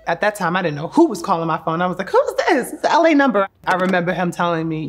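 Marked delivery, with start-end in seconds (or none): 2.1-3.5 high, loud burst of bewildered surprise; acted-out baffled outburst at an unknown caller; retold bewilderment. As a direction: blurt it out high and loud, replaying the confusion. Retelling reaction to unknown caller.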